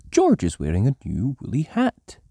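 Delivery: ordinary delivery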